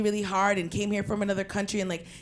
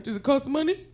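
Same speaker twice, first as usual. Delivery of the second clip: dad's shrug; 3 semitones higher than usual. Retold or imitated. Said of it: "As long as he's like".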